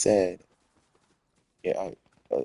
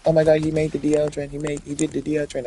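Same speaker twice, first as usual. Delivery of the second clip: high, fast gossipy mimicry; high fast mock gasp of gossip; 7 semitones higher, 3 dB louder, faster than usual. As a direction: imitate the gossips breathlessly. Mocking gossiping people.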